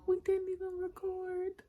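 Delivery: dismayed exclaim. Retold or imitated. Retold dismay to Shavonna.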